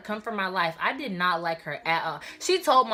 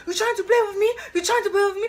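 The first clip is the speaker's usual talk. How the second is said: much higher and louder, a scolding shout; mom's furious yell; 13 semitones higher, 5 dB louder, faster than usual. Retold or imitated. African mom scolding her daughter at the door, furious shout. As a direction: blast it like an enraged mother. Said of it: "She was like".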